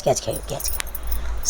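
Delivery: soft whispered coaxing to the dog. Real, not acted. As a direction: drop to a breathy whisper, luring him to play. (कुजबुजत, हळूच लाडीगोडीने)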